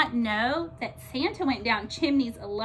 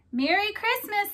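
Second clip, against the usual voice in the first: high, cheerful greeting; jolly Santa; 9 semitones higher than usual. A narrator's reading. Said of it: "said Santa".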